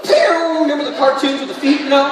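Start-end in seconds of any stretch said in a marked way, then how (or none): 0.0-0.6 high, bright cartoon getaway sound; cartoon zoom noise. Prisoner fleeing the open jail, cartoon zoom noise. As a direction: zip off like a cartoon runner. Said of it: "you'd be like".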